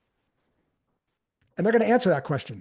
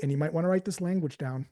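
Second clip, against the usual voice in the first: ordinary delivery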